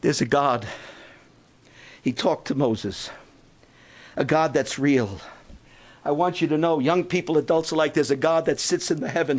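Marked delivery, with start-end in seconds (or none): none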